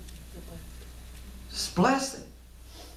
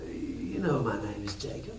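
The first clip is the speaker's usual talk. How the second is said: hushed confession; 12 semitones lower, 9 dB quieter than usual. Acted.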